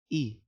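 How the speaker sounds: short ई as in igloo; the sound i. Shown as a demonstration. Teacher showing the i sound. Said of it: "ई का आवाज आ रहा है".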